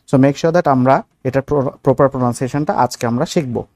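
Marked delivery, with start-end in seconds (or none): none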